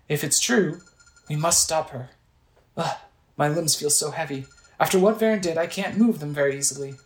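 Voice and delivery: acted worried game character; Impa, shaken and earnest. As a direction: plead with the hero, dramatic and grave. Voices Impa after possession.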